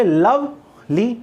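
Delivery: "Lovely" drawn out as लव, pause, ली; syllable by syllable. Shown as a demonstration. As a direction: slowly split "lovely" before ली. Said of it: "lovely को कैसे बोलोगे?".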